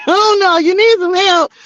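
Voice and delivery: high laughing "oh no". Real, not acted. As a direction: laugh it out, teasing.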